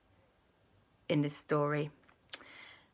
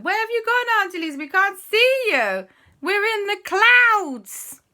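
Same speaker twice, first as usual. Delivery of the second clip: high, loud cries to someone lost; calling out; 14 semitones higher, 8 dB louder than usual. Acted. Children call to their aunt in the clouds, high shouting. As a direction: holler it, stretching the last word.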